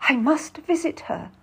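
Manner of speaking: high-pitched urgent declaration; insistent. A narrator's reading. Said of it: "Lucy insists".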